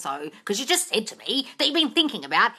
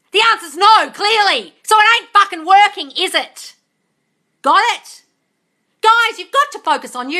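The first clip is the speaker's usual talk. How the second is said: loud, higher scolding rant; exasperated shouting; 11 semitones higher, 12 dB louder than usual. Real, not acted. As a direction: yell at them to get it through. Exasperated ranting at viewers.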